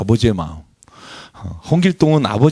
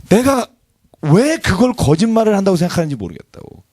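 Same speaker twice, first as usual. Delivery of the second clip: raised, indignant retelling of a friend; why would they think I lied, pitched up high; exasperated quote; 7 semitones higher than usual. Retold or imitated. Quoting friend's frustration. (억울한 듯 높인 인용)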